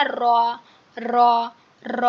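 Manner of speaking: র with a rolled, vibrating tongue; trilled r. Shown as a demonstration. Teacher demonstrating a trilled র to students. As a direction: roll the র like children's play sounds. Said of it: "বাচ্চারা যেমন মুখ দিয়ে হচ্ছে শব্দ করে".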